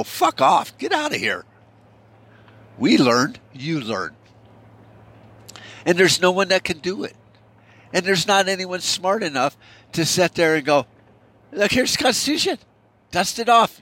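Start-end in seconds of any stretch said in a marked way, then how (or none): 0.0-1.5 mocking high dismissal. Mexicans turning Americans away. 11.5-13.8 mock eager urging. Imagined smart man urging action.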